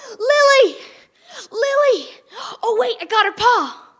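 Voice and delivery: high, loud calling for the dog. Acted. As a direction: shout her name through the smoke.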